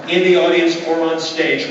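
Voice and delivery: ordinary talk, nothing marked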